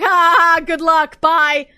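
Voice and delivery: loud taunting ha ha ha, then a breezy goodbye; jeering cackle and a cocky farewell; mocking villain laugh. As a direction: gloat at the detective on the way out. Villain taunts while escaping.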